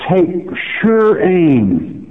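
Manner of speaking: slow, lifted stress on sure; slow emphasis. Shown as a demonstration. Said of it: "put the scope to it".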